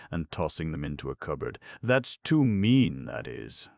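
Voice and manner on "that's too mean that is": scornful. A narrator's reading. Bates scornful.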